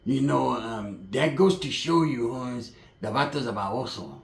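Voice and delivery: low, soft, slow gangster voice; imitating Pepe. Pepe about the shooting, low slow mock gangster. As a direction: drag it out, low and cold. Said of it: "he's like".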